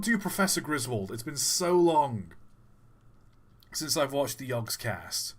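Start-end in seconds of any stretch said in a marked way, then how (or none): none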